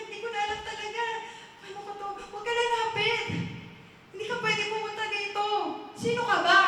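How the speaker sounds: high, hurried desperate warning; acted panic. Man warning a stranger off his secrets, panicked.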